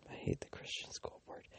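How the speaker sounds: soft, voiceless whisper of a secret grudge; hushed whisper, telling a secret. Real, not acted. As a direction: whisper it like a confession.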